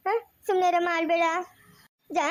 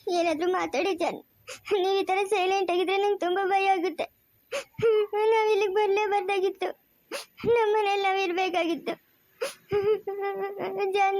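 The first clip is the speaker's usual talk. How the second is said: crying plea. Acted. Wife begs her unconscious husband to speak, crying. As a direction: beg him to speak through tears.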